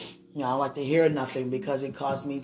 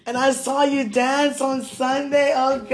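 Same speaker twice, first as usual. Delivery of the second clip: excited gushing; 7 semitones higher, 6 dB louder, slower than usual. Real, not acted. Gushing over his dancing.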